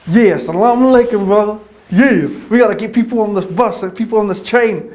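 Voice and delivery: high, loud eager greeting; loud high-pitched zealous excitement; hyped up. Retold or imitated. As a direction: fire everyone up to join.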